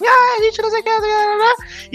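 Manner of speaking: put-on high excited children's babble; euphoric kids. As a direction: imitate the kids bursting with excitement. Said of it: "descem completamente eufóricas".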